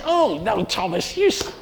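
a hint of a fussy objector; gently mock tut-tutting; scolding listener. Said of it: "some of you might say".

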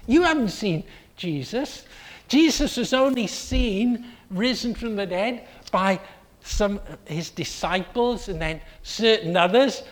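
ordinary talk

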